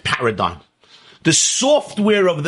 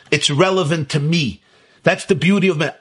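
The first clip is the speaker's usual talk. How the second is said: ordinary delivery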